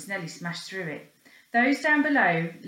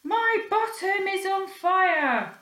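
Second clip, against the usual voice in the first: high, slow, alarmed wail; boy wailing as his bottom burns; panicked; 11 semitones higher, 4 dB louder, slower than usual. Acted. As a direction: stretch it out in panic. Boy panicking on burning banister.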